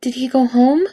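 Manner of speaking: put-on scared toddler voice; frightened child. A narrator's reading. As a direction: ask like a child afraid to be left. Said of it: "face abruptly filled with fear".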